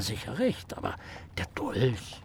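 soft unvoiced doubt; whispered uncertainty; hushed whisper. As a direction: let it fade to a whisper. Hesitant hush about the murder.

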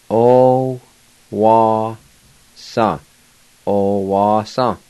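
O wa sa said slowly, syllable by syllable; slow demo. Shown as a demonstration. Teacher pronouncing O wa sa slowly for students. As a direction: spell O wa sa out slowly for learners. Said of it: "And now I'll go back and pronounce them".

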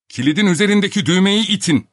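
high, loud, urgent order; shouted instruction. A narrator's reading. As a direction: shout directions through a door. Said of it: "diye bağırdı Filip Filipoviç". (bağırarak talimat verme)

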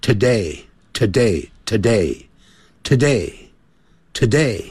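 "today" repeated slowly with a weak, light first syllable; slow repetition drill. Shown as a demonstration. Teacher drilling students by repetition, slow weak-syllable today. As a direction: say "today" over and over, slow, the to barely there. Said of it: "念成".

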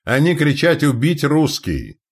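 high, loud cry; high cry, slowing at the end; shouting. A narrator's reading. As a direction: shout the enemy's words to the crew. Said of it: "крикнул Треска".

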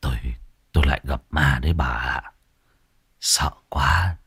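trembling, frightened. A narrator's reading. Old man tells wife he saw ghosts, hushed and shaking. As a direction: barely dare to say it, half whisper. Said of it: "Lão Bằng run rẩy".

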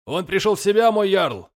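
high, loud call to the jarl; shouted report. A narrator's reading. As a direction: call out across the deck. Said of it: "крикнул Лирак".

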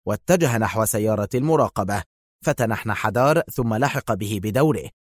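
plain reading voice

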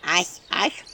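grumbly little spirit answer; house spirit reply. Acted. House spirit answering from behind the stove. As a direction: grunt it while chewing.

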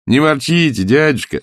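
high, coaxing lilt; cheerful nephew. A narrator's reading. Nephew soothing his grumpy uncle, coaxing. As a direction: coax him out of his grumbling.

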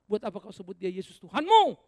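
loud, rising on Tuhanmu; loud rebuke. Real, not acted. Preacher confronting the congregation, loud rebuke. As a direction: throw the question hard at them.

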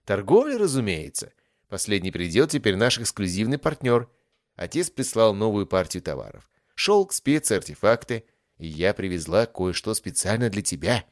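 a pleased trader showing off her goods; lightly voiced cheerful young merchant girl. Song greets Darion, voiced.

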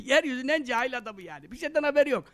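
ordinary talk

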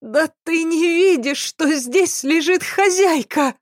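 high-pitched, snuffly character voice; nasal, tearful maid. A narrator's reading. Weeping maid to sleepy servant over the body, high nasal voice. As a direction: whine it high through a blocked nose. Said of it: "проговорила гнусавым голосом".

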